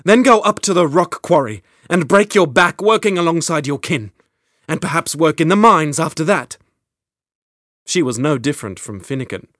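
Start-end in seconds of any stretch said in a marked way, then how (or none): 0.0-6.6 louder, higher, biting sarcasm; sharp sarcasm. Acted. Queen mocking Finnegan's plan, loud sharp sarcasm. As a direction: lash him with mocking suggestions. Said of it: "she said sharply".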